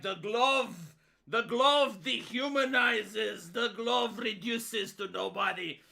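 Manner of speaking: slow, portentous mock speech; mock Jeremy. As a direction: ham up the grave glove metaphor, slowly. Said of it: "you have Jeremy, and it's like".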